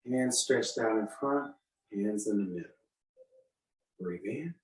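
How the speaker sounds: soft, unhurried movement cues; serene tai chi cues. Shown as a demonstration. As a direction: calmly talk them through the form. Leads a tai chi form.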